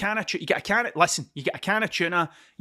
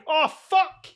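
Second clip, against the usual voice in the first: sudden higher exclamation; startled swear; 10 semitones higher, 4 dB louder than usual. Real, not acted. Startled when a guy walks by mid-sentence, sudden yelp. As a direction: caught off guard, blurting it out.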